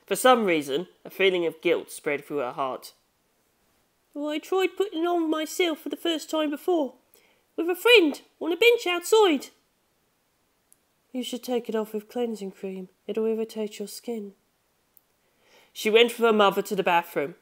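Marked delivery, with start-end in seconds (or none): 4.1-9.5 put-on much higher young girl voice, a bit sheepish; Chiho speaking. Chiho confessing to her mother about the makeup, higher girl voice. 11.1-14.3 softer, slightly higher mother voice; gentle hushed motherly caution; mother's advice. Acted. Mother to daughter about the makeup, soft and hushed.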